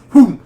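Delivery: a sudden loud whoosh effect; whoom sound. Retold or imitated. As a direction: mimic the snap of a crocodile strike. Crocodile snatch sound effect.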